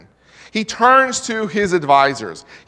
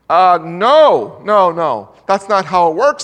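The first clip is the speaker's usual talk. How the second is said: louder panicked protest; protesting advisors; 7 dB louder than usual. Retold or imitated. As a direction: push back hard, voice raised. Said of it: "they say".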